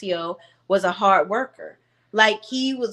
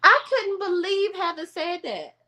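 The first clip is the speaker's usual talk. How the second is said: pitched-up, half-laughing disbelief; high disbelief; 10 semitones higher than usual. Real, not acted. Vlogger stunned by Heather's comment, high disbelief. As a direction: squeal it in disbelief. Reacting to reality show moment.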